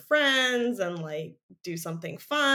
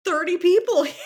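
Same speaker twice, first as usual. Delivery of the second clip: loud, higher-pitched disbelief; incredulous retort; 6 semitones higher, 5 dB louder than usual. Retold or imitated. Podcaster mocking the character's claim about a French girl, incredulous retort. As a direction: exclaim it in mounting disbelief.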